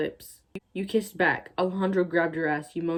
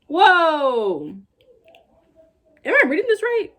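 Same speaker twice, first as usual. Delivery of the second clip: shocked disbelief; 10 semitones higher, 8 dB louder, slower than usual. Real, not acted. Shocked by the fanfic line.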